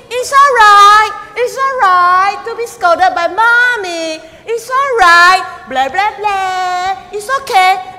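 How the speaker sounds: slow, high, loud sing-song reassurance; animated comfort. Retold or imitated. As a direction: cheer the scolded baby up big. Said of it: "I was very, very animated".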